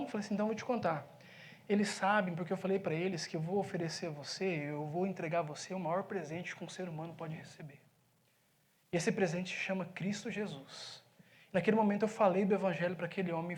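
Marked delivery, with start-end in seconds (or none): 0.3-11.0 hushed, solemn reveal. Retold or imitated. Pastor gently telling the pizza man about Christ, hushed. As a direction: confide the gift like a secret.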